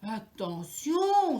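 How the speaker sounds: loud, slow drawn-out warning; father mouse crying out a loud warning. A narrator's reading. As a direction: stretch the warning as you fall. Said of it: "s'écrie Papa Souris".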